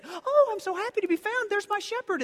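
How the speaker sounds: very high, loud squeaky sheep voice; mock happy sheep. Preacher voicing a found sheep, high and squeaky. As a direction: chirp it like a giddy lost sheep. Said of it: "they do not readily run up and say".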